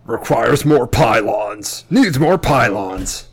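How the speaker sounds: slow, loud robotic game announcer. Retold or imitated. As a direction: drag out the game's warning like a computer. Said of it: "StarCraft, the original StarCraft".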